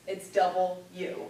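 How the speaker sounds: "double U" as two clear words, slowly; slow, spell out "double U" crisply. Shown as a demonstration. Teacher shows students how to say the letter, slowly. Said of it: "This letter is two words".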